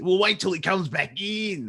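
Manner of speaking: high, mock Australian accent from a film; fake Australian accent. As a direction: imitate a film's failed Australian accent. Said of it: "they couldn't do an Australian accent".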